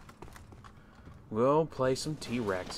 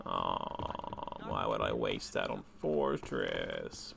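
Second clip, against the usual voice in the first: very low, quiet, slow grumble; drawn-out, disdainful low groan; grumbling; 9 dB quieter, much slower than usual. Real, not acted. Grumbles at a map choice.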